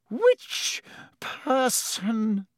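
very slow, high, trembling question; shaking voice. A narrator's reading. Professor McGonagall addressing the stunned crowd, voice shaking. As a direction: draw it out, voice shaking. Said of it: "her voice shaking".

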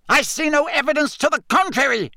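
very high outraged retort; high, strained indignation; angry shout. A narrator's reading. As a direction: shout back, matching their anger. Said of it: "shouted Fudge".

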